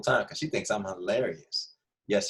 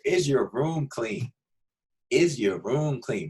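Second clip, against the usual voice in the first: drawn-out, pointed question; slow teasing repeat; 2 semitones higher, much slower than usual. Acted. Teacher teasing kids about messy rooms, slow and pointed. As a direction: stretch each word to make them squirm.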